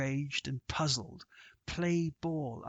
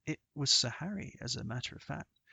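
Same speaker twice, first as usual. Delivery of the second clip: plain reading voice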